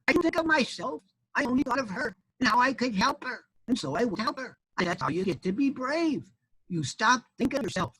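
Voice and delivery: high little chick voice explaining; wise baby chick. Acted. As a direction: explain it earnestly as a small bird.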